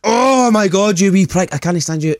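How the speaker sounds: high exasperated outburst. Retold or imitated. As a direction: blurt it out high and fed up. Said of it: "I'd be like".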